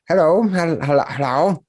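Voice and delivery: put-on a bashful young orc saying hello; a teenage orc meeting the heroes.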